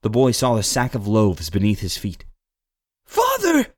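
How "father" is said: put-on very high-pitched child's cry; boy's shout. A narrator's reading. Boy finds his father held by the official, shouting. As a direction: call out in alarm as a boy. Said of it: "the boy shouted".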